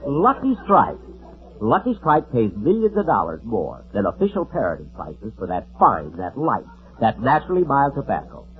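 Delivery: hammed-up commercial read; mock ad pitch. Jack recites the Lucky Strike ad to scold Don, announcer style. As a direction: reel off the sponsor copy like a radio announcer.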